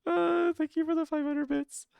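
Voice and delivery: high, bright burst of gratitude; excited thanks. Real, not acted. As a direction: thank them loudly and delighted. Big donation thanks.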